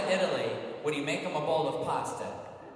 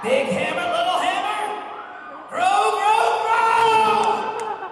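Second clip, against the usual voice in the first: much higher, louder shouted attack call; anime battle cry; 12 dB louder, much slower than usual. Acted. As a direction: belt it out like an anime hero. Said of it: "That's what Jason Liebrecht would do".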